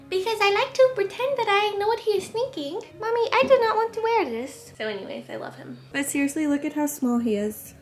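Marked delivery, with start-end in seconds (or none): 0.1-4.7 put-on high whiny baby voice. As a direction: voice the puppy as a baby. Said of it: "talk in a baby voice".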